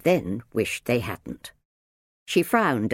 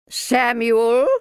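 loud, high, insistent correction; correcting; 12 semitones higher, 7 dB louder than usual. A narrator's reading. Minder corrects Sam's name, insistent. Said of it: "the minder corrected him".

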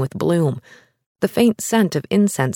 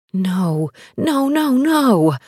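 horrified bride; 7 semitones higher, much slower than usual. Acted. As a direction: panic climbing to a cry.

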